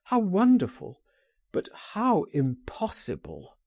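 a rapturous exclamation that falls into doubt; delighted cry. Acted. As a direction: cry out in wonder, then sink back. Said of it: "he cried".

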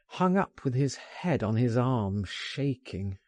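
plain reading voice

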